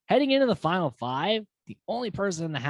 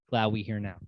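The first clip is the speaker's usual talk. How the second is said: usual speaking voice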